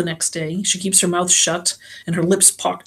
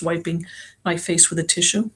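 usual speaking voice

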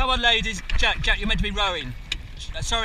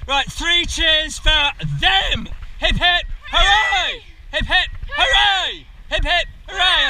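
race coxing calls; 7 semitones higher, slower than usual. Real, not acted. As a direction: push the crew hard, shouting each stroke. Cox calling rowing race.

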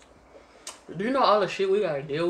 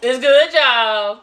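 loud, high delighted praise; excited; 6 semitones higher, 11 dB louder, much slower than usual. Real, not acted. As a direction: rave about the food. Tasting the mac and cheese.

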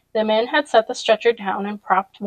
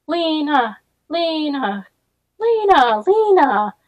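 crowd calling; 6 semitones higher, slower than usual. A narrator's reading. Scattered townspeople calling out for their hero Lena. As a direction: call out her name, cheering. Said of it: "cried a few scattered voices".

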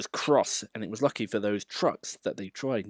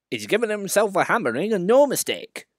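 much higher, louder hearty fireman; rough fireman; 10 semitones higher, 5 dB louder than usual. Acted. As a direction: shout it over the rattling.

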